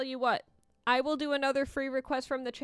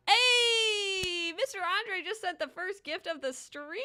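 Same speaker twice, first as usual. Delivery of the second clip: excited; 8 semitones higher, slower than usual. Real, not acted. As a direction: cheer the first gift out. First gift arrives on stream.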